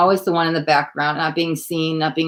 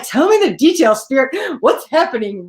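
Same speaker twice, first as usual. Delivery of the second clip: higher, louder, pleading with the spirits; eager plea; 6 semitones higher, 3 dB louder than usual. Retold or imitated. Reader retelling her plea to Spirit, excitedly. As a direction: beg Spirit for details, keyed up. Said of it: "I'm like".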